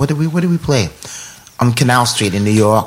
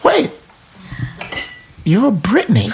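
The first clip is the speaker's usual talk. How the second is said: retold amazement; 8 semitones higher, 4 dB quieter than usual. Retold shock at recognising [name].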